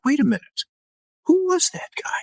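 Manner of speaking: high astonished murmur; amazed kids. Retold or imitated. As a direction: kids stunned by the priest.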